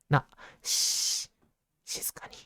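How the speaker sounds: hushed whisper, slow. Acted. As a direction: shush the listeners softly.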